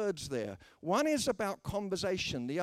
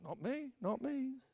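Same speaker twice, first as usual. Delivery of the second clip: small, high, soft voice; mock-sheepish squeak to the onlookers; embarrassed disclaimer; 8 semitones higher, 7 dB quieter than usual. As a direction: shrink away in a soft, higher pitch. Disowning evangelist to staring passengers.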